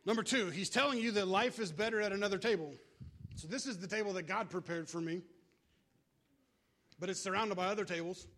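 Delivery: ordinary delivery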